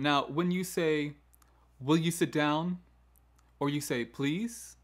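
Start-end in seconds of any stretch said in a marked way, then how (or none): 1.8-2.8 "down" lifted at the end as a question; asking as a question. Shown as a demonstration. Teacher unsurely asking a pupil to sit, questioning. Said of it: "is asking a question". 4.0-4.8 "Please" rising like a question; upward inflection. Shown as a demonstration. Teacher asking a pupil, "Please" rising questioningly. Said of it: "the inflection of that please going up".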